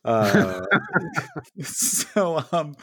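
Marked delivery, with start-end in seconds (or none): 0.7-1.6 sudden squealing laugh up high; real high squeal of laughter; burst of high laughter. Real, not acted. Laughing at own joke.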